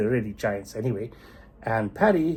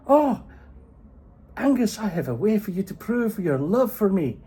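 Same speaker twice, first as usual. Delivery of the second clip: sweet, higher put-on wifely voice; high coaxing wife; 11 semitones higher, 5 dB louder than usual. Wife sweetly coaxing her husband Angus to fight. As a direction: wheedle him into it.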